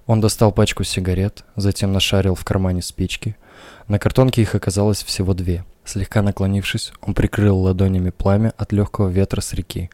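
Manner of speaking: ordinary delivery, nothing marked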